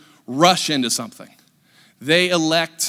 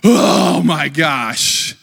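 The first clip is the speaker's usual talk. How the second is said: long drawn-out, exasperated sigh of words; exasperated groan; 4 dB louder, much slower than usual. Acted. Preacher acts travelers hearing of a delay, exasperated groan. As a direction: groan it out very slowly, fed up. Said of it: "what's the reaction of everybody".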